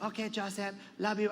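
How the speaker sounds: soft, frail grandmother; Lola Tinay. Retold or imitated. Grandmother in bed tells grandson goodnight, softly. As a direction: answer softly as a tired grandmother. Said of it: "She's like".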